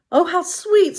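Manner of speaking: high, sweet princess voice. Acted. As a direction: coo it like a touched princess.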